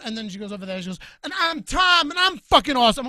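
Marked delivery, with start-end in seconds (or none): 1.2-3.1 higher and louder mocking bro voice; mocking dude voice. Girl mocking Bob's intro, in a higher, louder bro voice. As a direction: puff up like a cocky dude. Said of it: "We don't sound like that".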